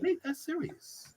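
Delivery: high, soft, cooling-down voice; calm-down reply. Retold or imitated. Answering a shouty text, high and soft calm-down. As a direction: gently tell them to chill.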